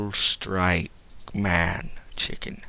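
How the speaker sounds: ordinary delivery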